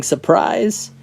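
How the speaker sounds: joke-teller's mock surprise shout; retold group yell. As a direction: imitate a crowd springing a surprise. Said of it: "all yelling".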